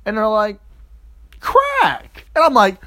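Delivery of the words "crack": mock reveal. Friends reveal the news.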